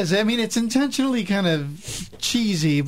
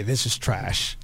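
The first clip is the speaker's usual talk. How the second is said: deadpan dismissal; 9 semitones lower, 4 dB quieter, slower than usual. Retold or imitated. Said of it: "I'm sure she said it exactly that way".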